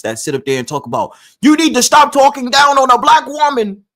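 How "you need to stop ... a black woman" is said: much higher, louder whiny mock voice; mocking opponents. Mocking the idiots in his argument sprees, high and whiny. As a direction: parody the people lecturing him. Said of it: "argument sprees with these idiots".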